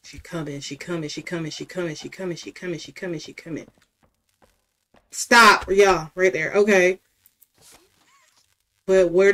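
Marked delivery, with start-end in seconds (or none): 0.0-3.7 soft frantic repeated murmur; scared murmur. Real, not acted. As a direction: mutter it fast in panic. Monster approaching.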